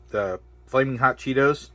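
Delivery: usual speaking voice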